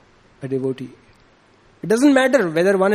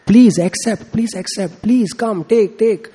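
higher, urgent mock begging; imitated tearful plea; 5 semitones higher, 4 dB louder, slower than usual. Crying child begs the Lord to accept his offering, imitated pleading. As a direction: plead in short repeated bursts, acting upset. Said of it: "he starts crying".

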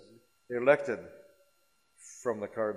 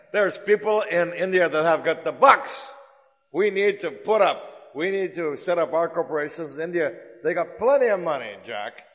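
pushy excited pitch; 6 semitones higher, 9 dB louder than usual. Acted. Executive John pushing his boss.

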